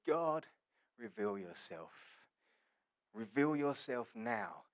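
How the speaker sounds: low, soft appeal to God; quiet prayer. Acted. Elijah praying before the watching nation, quiet prayer. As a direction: pray it softly and slowly, with weight.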